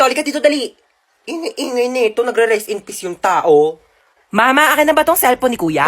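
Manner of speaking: ordinary delivery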